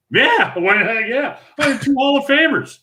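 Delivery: high-pitched, eager yes, retold; eager acceptance. Eagerly accepting invitation from famous bowlers.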